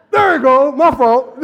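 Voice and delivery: loud, laughing apology; preacher laughing at his own mistake. Real, not acted. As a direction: laugh it off after losing your place. Laughing at own reading slip.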